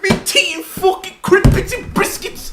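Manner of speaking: high, loud laughing fake Cockney; hammed-up Cockney accent; mock Cockney. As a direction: rattle it off like a cartoon Londoner. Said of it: "Cockney person".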